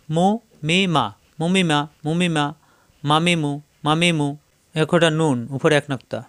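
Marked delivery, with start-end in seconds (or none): 0.1-4.3 মুমি মা as brisk joined syllables; quick short vowels. Shown as a demonstration. Teacher drilling meem vowels for students, quick joined syllables. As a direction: chant each short group with a gap after it. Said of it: "হারকাতের উচ্চারণ তাড়াতাড়ি".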